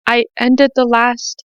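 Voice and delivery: ordinary delivery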